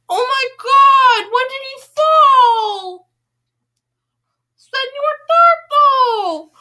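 high, loud, slow panicked wail; panicked shriek. Real, not acted. Reader panics over her turtle squishy falling. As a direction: cry out for the fallen toy.

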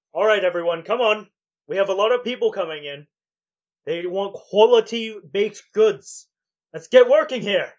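loud head baker spurring on staff; rallying, motivating. Acted. Priest urging bakery workers on, loud and rallying. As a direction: call out briskly to rouse the workers. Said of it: "motivating the other workers".

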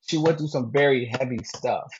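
normal talking voice

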